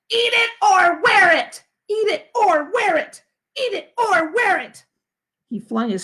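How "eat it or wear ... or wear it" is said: high, loud slow tantrum chant; screaming toddler. Acted. Fudge to his father in a tantrum, screaming. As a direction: yell it over and over like a furious little boy. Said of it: "Fudge screamed".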